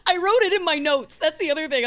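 high, loud, worked up after a laughing fit; still cracking up. Real, not acted. Host confesses she wrote the joke in her notes, still cracking up. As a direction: blurt it out high, fresh from cracking up. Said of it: "couldn't even get through it".

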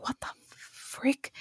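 hushed breathy what the frick; a stunned whisper in the dream; whispered shock. Retold or imitated. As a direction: whisper it in disbelief. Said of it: "And I'm like".

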